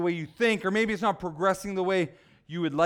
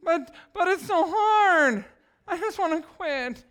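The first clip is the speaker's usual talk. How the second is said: higher, slow drawn-out whine of a quitter; whiny voice; 7 semitones higher than usual. Retold or imitated. Pastor mocks a discouraged believer, in a whiny voice. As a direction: moan it like someone who wants to give up. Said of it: "I can't do a whiny voice".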